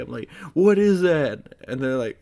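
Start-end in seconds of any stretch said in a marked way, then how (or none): 0.5-1.5 loud, high, drawn-out alarmed question; freaking out. Retold or imitated. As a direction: cry it out in retold panic. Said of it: "I freak out".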